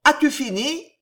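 high, loud impatient call from below; shouted question. A narrator's reading. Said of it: "cria d'en bas la voix de Guise".

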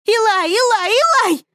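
high, loud repeated yell; shouting the name. A narrator's reading. Daughter screams her dead brother's name at mother, loud. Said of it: "кричу я".

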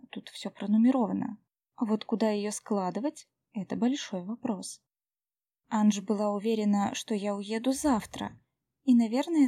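ordinary delivery, nothing marked

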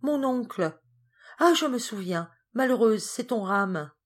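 put-on a girl remembering something awful, mild alarm; sudden dismay. Geneviève recalling her uncle, lightly acted dismay.

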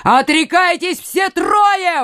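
high, drawn-out shouted command; exclaiming. A narrator's reading. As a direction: shout it slowly, pitched high. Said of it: "воскликнул Коконас".

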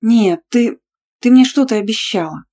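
insistent, mildly accusing complaint. A narrator's reading. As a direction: hold them to their promise, reproachfully. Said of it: "обвиняюще сказала она".

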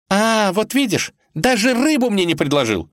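high, triumphant reproach; aggrieved. Acted. Vasilisa catches Ivan out in their quarrel, aggrieved. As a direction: pounce on his slip in a high voice.

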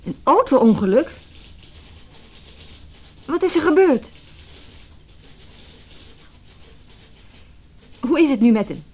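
high, loud panicked questions; shocked news on the phone; frantic and afraid; alarmed. Acted. Hospital phone call about accident.

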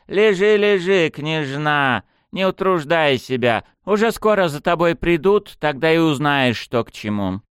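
high, loud gloating sneer; mocking. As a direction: lord it over her. Captor taunting bound princess.

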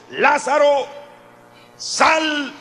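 high, loud summoning cry; loud call. A narrator's reading. Jesus calling Lazarus out of the tomb, loud high cry. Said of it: "gritó con fuerte voz".

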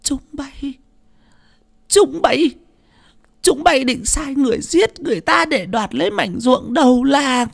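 very high accusing screech; bitter fury. A narrator's reading. Old mother accusing her children of plotting murder, bitterly furious. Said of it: "Bà già dậm mạnh cái gậy trúc xuống nền nhà mà hằn học".

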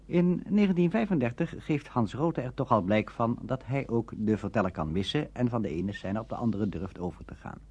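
ordinary delivery

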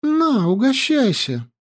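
put-on high, lively young girl; girl's voice. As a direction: chatter high and breathless.

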